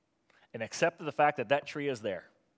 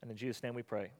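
earnest spoken prayer. Acted. Leads a closing prayer.

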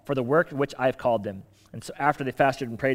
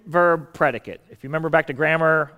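ordinary delivery

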